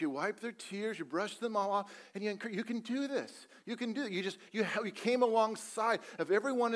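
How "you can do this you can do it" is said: softer, higher coaxing voice; encouraging parent. Pretend. Parent coaxing a child back up to walk, soft and encouraging. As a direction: cheer a wobbly toddler on gently.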